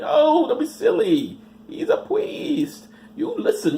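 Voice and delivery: high, slow mock-parent voice; dismissive parent. Mother brushing off her scared child, high mock voice. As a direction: brush off the kid like a smug mother.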